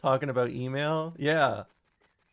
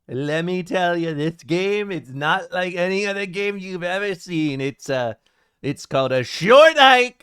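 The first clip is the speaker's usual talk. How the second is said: Trump impression; 6 dB louder than usual. Trump bragging to Bubba about a short hike, loud. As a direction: boom it out like Trump at a rally. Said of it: "Trump tells Bubba how great a short hike is".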